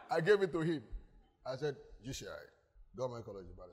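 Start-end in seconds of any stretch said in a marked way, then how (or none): 2.0-3.7 very low, hushed retold answer; understated reply. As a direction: drop it low and soft, quietly sure. Cool boastful reply to teacher retold.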